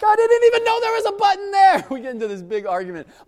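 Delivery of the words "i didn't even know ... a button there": much higher, louder defensive whine; whiny protest. Retold or imitated. Teen arguing back at the boss, high whiny protest. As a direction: protest your innocence like a kid.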